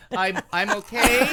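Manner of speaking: hostage voice. Pretend. Mock hostage video line.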